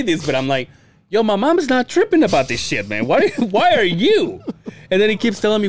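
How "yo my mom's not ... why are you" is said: higher, laughing disbelief; mock retort through laughs. Son's unsaid reply to dad, laughing.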